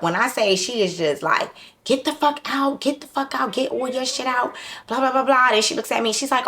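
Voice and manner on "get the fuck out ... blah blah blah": high-pitched imitation of a fired-up mother; retold mother going off, voice pushed up high. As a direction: mimic her ranting at him, pitched high. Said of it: "she is fired up".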